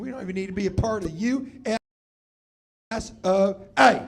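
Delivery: proud Texan declaration; Texan accent. Acted. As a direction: drag out the last letters in a Texan accent. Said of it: "that's a Texan accent".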